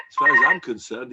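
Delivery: loud scornful outburst; indignant townsman dismisses the rabbi loudly. Retold or imitated. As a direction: blurt out your contempt.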